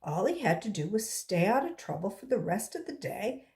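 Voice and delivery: ordinary delivery, nothing marked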